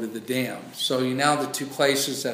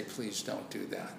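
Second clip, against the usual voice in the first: faint, softer pleading demon line; mock begging whisper-soft plea; 6 semitones lower, 14 dB quieter than usual. Preacher mimics humans who won't beg Jesus, softly pleading. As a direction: beg meekly, barely voiced. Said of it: "and say".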